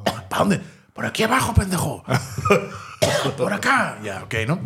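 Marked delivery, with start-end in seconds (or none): 0.0-0.6 high mock call for directions. Lost visitor asks the man upstairs, calling out. 0.9-4.0 high, strained mock shout; calling down. Chainsaw man directs the lost visitor, mock shouting.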